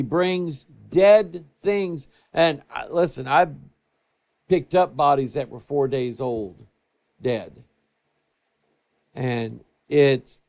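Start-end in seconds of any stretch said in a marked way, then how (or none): none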